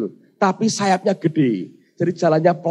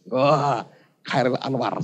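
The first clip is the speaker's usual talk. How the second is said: ordinary talk, nothing marked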